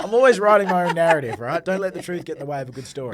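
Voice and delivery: real, high-spirited joking; amused boasting. Real, not acted. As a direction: crow about it as the laughs roll. Joking, both men laughing.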